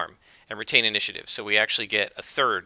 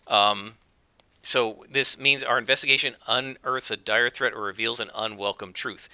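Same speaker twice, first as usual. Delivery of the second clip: ordinary talk, nothing marked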